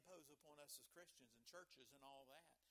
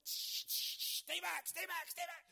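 much higher and louder shouting, hissing at the lions; lion tamer; 10 semitones higher, 14 dB louder than usual. Retold or imitated. Daniel pictured as a lion tamer with a chair and whip, yelling. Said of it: "with a chair and a whip going".

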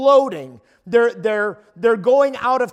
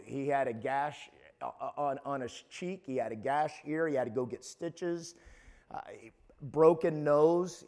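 normal talking voice

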